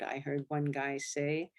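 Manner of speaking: ordinary delivery, nothing marked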